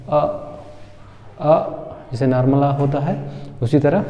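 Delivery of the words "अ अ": अ as a short, relaxed 'uh'; neutral vowel demo. Shown as a demonstration. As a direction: say अ briefly and on its own. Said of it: "ये आ का sound देगा". (छोटा सामान्य अ बोलें)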